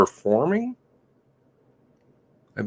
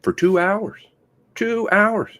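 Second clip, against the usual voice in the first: 1 incredulous; 9 semitones higher, slower than usual. Real, not acted. Incredulous repetition.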